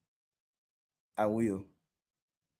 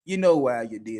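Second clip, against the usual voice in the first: ordinary talk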